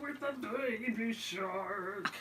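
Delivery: slow far crooning; slow jazzy croon. Pretend. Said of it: "my impression of [name]".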